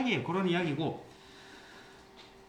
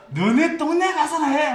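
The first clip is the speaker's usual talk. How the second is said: high, louder slow mock shout from the company commander; mock angry shout; 10 semitones higher, 8 dB louder than usual. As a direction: yell it like a laughing sergeant. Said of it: "이런 거 진짜 말하거든". (웃음 섞인 흉내 고함)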